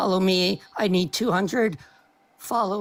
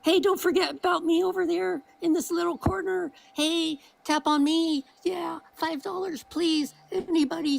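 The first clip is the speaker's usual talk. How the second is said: whiny begging voice; 11 semitones higher than usual. Pretend. Begging for attention from the corner.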